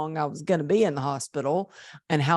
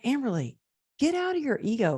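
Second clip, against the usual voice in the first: higher, brisk self-talk; a touch of scolding herself, higher; 8 semitones higher, faster than usual. Retold or imitated. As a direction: snap yourself out of it, higher. Her inner pep talk to herself.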